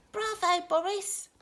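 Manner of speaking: high, bright cheer. Acted. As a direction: cheer him on. Friends cheering.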